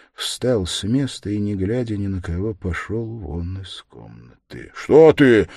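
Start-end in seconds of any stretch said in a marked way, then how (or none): 4.7-5.5 loud, high, startled shout; sudden raised cry; cried out. A narrator's reading. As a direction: call out sharply to stop him. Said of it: "крикнул Разумихин".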